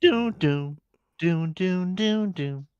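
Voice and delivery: high chanted music imitation; hummed entrance music. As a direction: sing the theme as doom doom.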